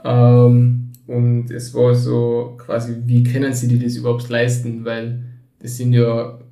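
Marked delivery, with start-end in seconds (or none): none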